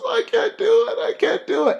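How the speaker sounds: high, loud mock breakdown; fake panic. Mom faking panic to trick Michael. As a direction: squeal it like she's losing her nerve. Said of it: "She says".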